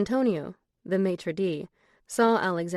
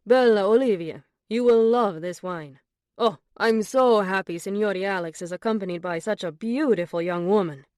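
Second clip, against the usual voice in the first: put-on louder, delighted Italian accent; 5 dB louder than usual. A narrator's reading. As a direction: charm her with a thick Italian accent. Antonio serves the wine.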